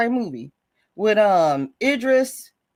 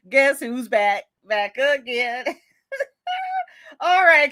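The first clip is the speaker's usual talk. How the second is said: ordinary delivery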